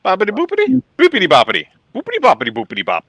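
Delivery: high, loud hammed-up Italian accent; over-the-top Italian accent, fast at the end; Italian accent guy. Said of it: "my Italian accent guy from Family Guy".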